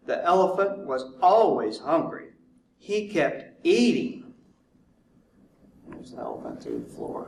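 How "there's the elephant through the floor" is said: hushed storyteller aside; whispering. Acted. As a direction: whisper it like a secret.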